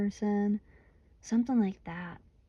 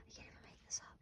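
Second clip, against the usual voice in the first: breathless whisper of disbelief; barely voiced, can't believe it; stunned whisper; 20 dB quieter, much faster than usual. Real, not acted. To viewers, a whispered aside in disbelief.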